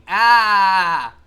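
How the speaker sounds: loud, open "Ah!" held long; drawn-out loud cry of "ah". Pretend. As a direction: belt out a sustained "ah". Mock yell with chat.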